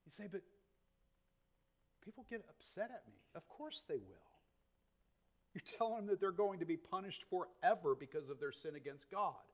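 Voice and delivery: very soft, confidential preaching; hushed counsel. Real, not acted. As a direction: reassure them in a near-whisper. Drops to confidential counsel after proclamation.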